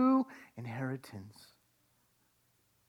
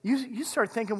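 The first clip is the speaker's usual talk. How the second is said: usual speaking voice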